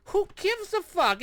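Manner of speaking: high, sneering mock voice, dragged out; mocking dismissive quote. Vlogger mocks the public shrugging off Afghanistan, high sneering scoff. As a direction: toss it off like nobody cares.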